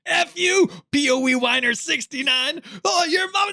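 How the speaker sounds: high-pitched hammed-up insult shouting; squeaky pretend outrage; mock angry rant. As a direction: play an unfiltered online troll ranting, way up high. Acting out unfiltered chat posts.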